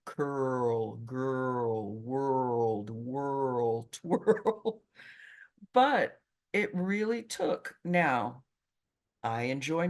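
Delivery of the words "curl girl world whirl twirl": put-on "curl, girl, world, whirl, twirl" with a strong curled American R; R drill words. Shown as a demonstration. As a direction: drill each word slowly, curling the R. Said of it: "I had exercises like".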